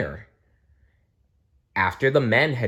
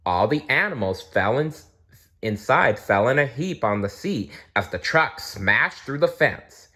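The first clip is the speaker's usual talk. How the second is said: ordinary delivery, nothing marked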